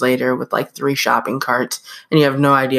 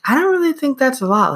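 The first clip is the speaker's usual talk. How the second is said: high, fast and dismissive; scoffing disbelief; 10 semitones higher, faster than usual. Retold or imitated. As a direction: brush it off like it's nothing. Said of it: "And I was like".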